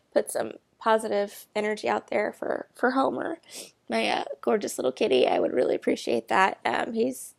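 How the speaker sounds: ordinary talk, nothing marked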